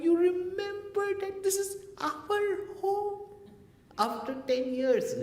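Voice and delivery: very high, tearful wail of joy; crying wife. Retold or imitated. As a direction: voice cracking through happy tears. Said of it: "And she started crying".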